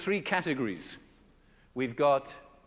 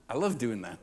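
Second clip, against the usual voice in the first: ordinary delivery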